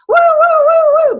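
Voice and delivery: high wailing woo-woo of a police siren; siren imitation. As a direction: mimic a siren going by, loud and high.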